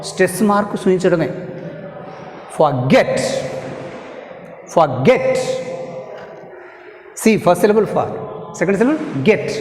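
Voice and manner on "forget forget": forGET, GET said more forcefully; teacher modelling forGET, stress on GET. Shown as a demonstration. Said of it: "Get is pronounced more forceful".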